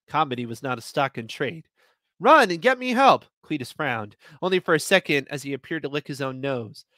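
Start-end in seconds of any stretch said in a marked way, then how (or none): 2.2-3.2 urgent plea. Acted. Desperate order, high loud.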